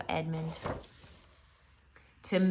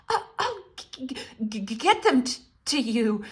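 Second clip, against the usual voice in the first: high stuttering with chattering teeth; 4 semitones higher, 4 dB louder than usual. A narrator's reading. Edmund answering the witch, teeth chattering. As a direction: shiver and stammer. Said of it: "through chattering teeth".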